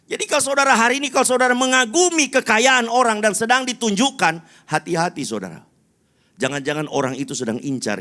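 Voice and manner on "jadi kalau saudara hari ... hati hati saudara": loud, high pulpit warning; full-force preaching. Real, not acted. Preacher warning the congregation about admiring wealth, full-force preaching. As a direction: drive the warning out at the crowd.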